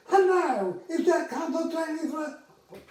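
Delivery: high, loud put-on voice of a prank caller; odd caller voice. Air traffic controller mimics a prank caller on the buzzer, high and loud. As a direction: squeak it out like a silly voice on the line.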